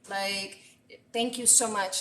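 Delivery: usual speaking voice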